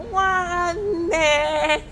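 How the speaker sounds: high, drawn-out bird voice; bird-like call. Shown as a demonstration. Teacher has pupils copy a bird, high piping voice. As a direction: pipe it long and high like a bird. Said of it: "whistle it like a bird".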